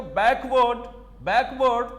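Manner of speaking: Backward with a slight stop after the K; slight mid-word pause. Shown as a demonstration. Teacher showing students the syllable break, backward lightly split after the K. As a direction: hold briefly at the K of backward, then finish. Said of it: "के के पास आप हल्का सा क्या करते हो? रुकते हो".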